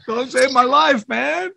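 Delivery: high, hammy whiny plea; mock high whine.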